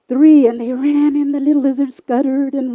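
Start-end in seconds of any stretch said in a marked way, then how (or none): none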